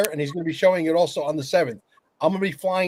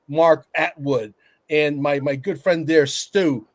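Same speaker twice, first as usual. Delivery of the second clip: normal talking voice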